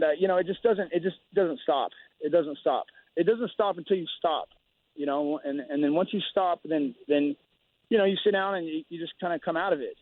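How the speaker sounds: usual speaking voice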